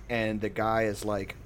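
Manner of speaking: normal talking voice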